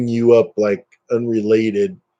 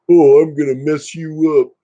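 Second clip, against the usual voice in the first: raised, louder mocking voice; mock threat; 5 semitones higher, 6 dB louder than usual. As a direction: put on a hammed-up silly threat. Mocking a petty disliker's threat.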